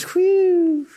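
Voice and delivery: high, long whistled-out whew; imitated relieved whew. Preacher acting out passengers saved from crashing, high whew of relief. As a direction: mimic a big exhale of relief. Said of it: "and everyone goes".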